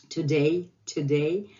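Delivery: "today" with a soft English D. Shown as a demonstration. Teacher contrasting English with Russian, soft D in "today". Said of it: "English is softer".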